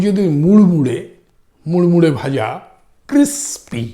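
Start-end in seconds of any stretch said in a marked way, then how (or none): 3.1-3.8 crispy in two separate beats, held high; split into syllables. Shown as a demonstration. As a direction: break crispy after the s, then say pi. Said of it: "দুটো syllable".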